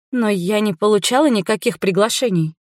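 ordinary delivery, nothing marked

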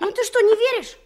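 laughing. Acted. Boy insisting, laughing.

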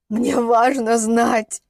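very slow, strained begging; desperate plea. Acted. Father needs the truth about his daughter, slow desperate plea. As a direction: drag out each word imploringly.

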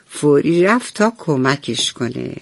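usual speaking voice

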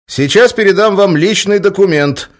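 a bodyguard announcing his move; warning. A narrator's reading. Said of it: "предупредил Олег".

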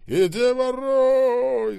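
long, high roar. A narrator's reading. Скоба breaking into the intercom talk, roaring. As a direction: bellow it and stretch it out. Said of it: "заревел Скоба".

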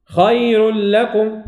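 ذلكم خير لكم chanted slowly, the ra of خير thin; Quranic recitation. Shown as a demonstration. Teacher recites verse to show light ra. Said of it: "لا نقول خير".

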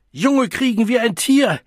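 high, loud sustained rant; furious bellowing. A narrator's reading. Mother blaming her child for her lost return home, bellowing. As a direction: keep shouting the blame in rage. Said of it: "brüllte sie".

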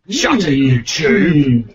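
slow, loud Cockney insult; Cockney accent. Shown as a demonstration. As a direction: bark it in a London Cockney accent. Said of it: "in a Cockney accent".

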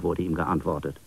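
plain reading voice

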